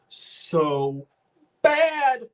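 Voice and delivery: high, drawn-out "bad"; dramatic lament. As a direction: stretch the word out high for drama. Dramatic retelling of feeling awful.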